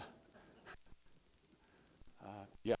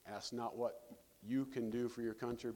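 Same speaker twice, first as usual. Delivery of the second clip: ordinary talk, nothing marked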